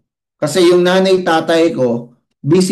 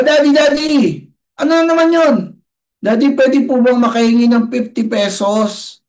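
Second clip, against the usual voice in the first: put-on child's voice; 7 semitones higher than usual. Child asking for money.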